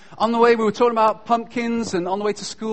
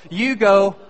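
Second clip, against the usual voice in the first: ordinary delivery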